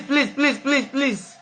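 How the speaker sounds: usual speaking voice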